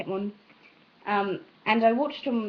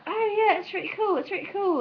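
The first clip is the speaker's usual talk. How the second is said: high-pitched gushing imitation; hammed-up enthusiasm, pitched way up; mock admiration; 10 semitones higher than usual. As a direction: gush like an impressed onlooker, much higher. Imitates people admiring her British accent.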